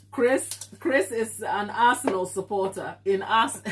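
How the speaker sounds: usual speaking voice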